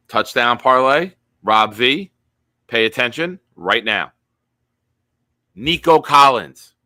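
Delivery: loud, punchy hype call; booming parlay pitch. Real, not acted. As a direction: grab Rob's attention with loud hype. Hyping parlay to Rob.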